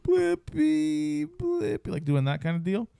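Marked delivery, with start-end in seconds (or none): 0.0-1.9 put-on high pleading toddler voice, much higher; whiny toddler. Dad imitating his toddler whining for Blippi. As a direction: beg for Blippi like a tired two-year-old. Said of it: "He's like".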